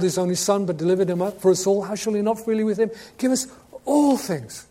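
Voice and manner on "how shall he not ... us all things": normal talking voice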